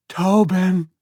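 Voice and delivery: high, loud anxious call. A narrator's reading. Mother calls her son, anxious. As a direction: call the boy back. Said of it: "The woman started to call".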